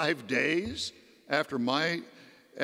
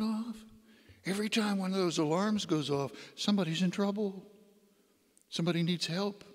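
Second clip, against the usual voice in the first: soft, higher, gently prompting voice; little inner voice; 4 semitones higher, 3 dB quieter than usual. Retold or imitated. God's little voice speaking to a patient, gentle prompting. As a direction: play a quiet voice nudging someone toward prayer. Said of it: "then that little voice".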